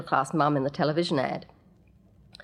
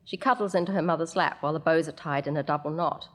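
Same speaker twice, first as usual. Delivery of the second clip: plain reading voice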